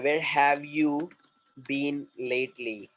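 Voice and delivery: drawn-out words with pauses between; slow model question. Shown as a demonstration. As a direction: model it slowly for learners. Teacher models a new English question. (धीरे-धीरे, शब्दों को अलग करके)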